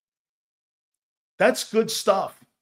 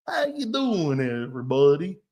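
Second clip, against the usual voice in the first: fake Southern accent; 4 semitones lower than usual. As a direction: exaggerate a fake Cajun accent. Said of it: "phony-ass accents".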